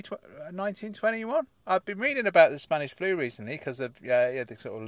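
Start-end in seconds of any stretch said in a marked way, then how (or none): none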